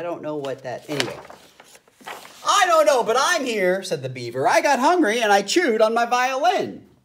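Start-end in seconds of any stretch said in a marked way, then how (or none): 2.5-3.8 high, loud eager beaver. A narrator's reading. 4.5-6.9 beaver voice. A narrator's reading.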